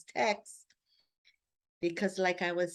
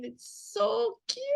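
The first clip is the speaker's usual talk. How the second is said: much higher and slowed, gushing over it; 14 semitones higher, slower than usual. Real, not acted. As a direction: coo it like something adorable. Gushing about her garden.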